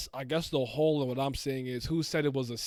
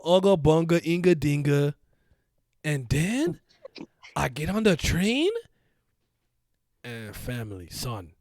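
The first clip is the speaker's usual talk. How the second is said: Simi impression; 6 dB louder, slower than usual. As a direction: ham up your friend telling a story. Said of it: "that's how Simi speaks".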